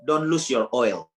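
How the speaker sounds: slow, weighty "Don't lose your oil."; preacher sealing the key line; solemn charge. Shown as a demonstration. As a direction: lay each word down slowly like a warning.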